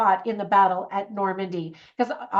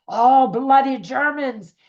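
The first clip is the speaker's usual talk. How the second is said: drawn-out, louder, higher mock exasperation; mock grumbling dismay; 4 semitones higher, 6 dB louder, slower than usual. Veteran finding his bus full of Germans, mock exasperated. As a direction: groan it out slowly in mock annoyance.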